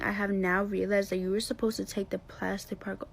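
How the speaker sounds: ordinary delivery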